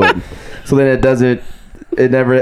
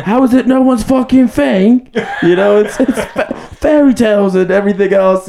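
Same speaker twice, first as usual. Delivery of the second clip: much higher, laughing mock outrage; ranting; 11 semitones higher than usual. Podcaster imitating the boss ranting about the city, hammed-up outrage. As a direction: rave in disbelief at the city. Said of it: "Going off about why it's amazing".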